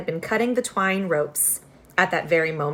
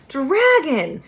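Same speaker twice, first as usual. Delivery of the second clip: higher, louder yell; screaming; 8 semitones higher, 5 dB louder than usual. Acted. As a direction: shout in panic. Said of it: "they screamed".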